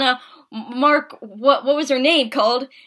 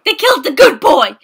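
louder upset repeat; 9 dB louder than usual. Real, not acted.

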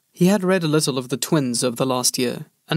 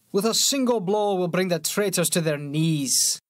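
scornful soldier; 4 semitones higher than usual. A narrator's reading. Bellis mocking the pauper prince, scornful soldier voice. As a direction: sneer at the rebels.

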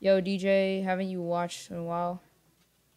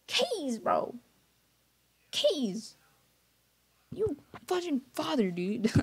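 excited yelling; 8 semitones higher, much slower than usual. Real, not acted. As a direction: hype shout welcoming the raider. Streamer reacting to a surprise raid.

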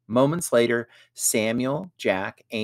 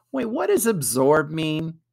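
puzzled; 7 semitones higher, 4 dB louder than usual. A narrator's reading. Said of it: "with a puzzled look on his face".

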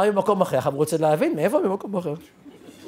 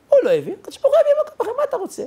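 high, rapid mock outburst; hammed-up incredulous protest; acted bafflement; much faster than usual. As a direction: rattle it off high, half laughing. Acting out the baffled reaction.

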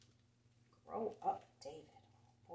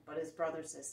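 usual speaking voice

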